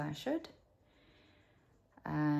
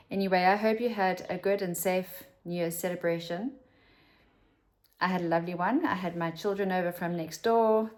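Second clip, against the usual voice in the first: ordinary talk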